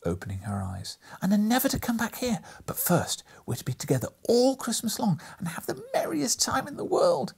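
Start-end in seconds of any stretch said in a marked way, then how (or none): 1.2-7.3 put-on high, bright, eager child's voice; gleeful little girl. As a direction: rattle it off like an excited child. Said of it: "said the child".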